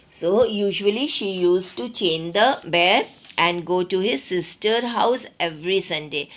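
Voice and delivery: ordinary talk, nothing marked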